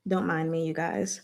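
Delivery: usual speaking voice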